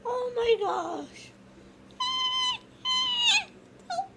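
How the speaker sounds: squeaky new mom, disgusted; high mom voice, grossed out. Acted. Plays the mother at diaper change.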